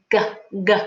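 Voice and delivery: hard "G" from the back of the throat; over enunciating. Shown as a demonstration. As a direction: spread the mouth and say "G" slowly. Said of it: "not touching my tongue up to the top".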